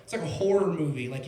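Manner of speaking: ordinary delivery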